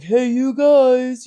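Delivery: Goonies guy impression. Quoting the Goonies guy to a viewer, slow and drawn out. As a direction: stretch it out like the Goonies kid. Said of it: "that guy from the Goonies".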